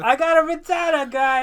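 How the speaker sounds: hammed-up knockoff New York accent. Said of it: "I don't know what accent this is".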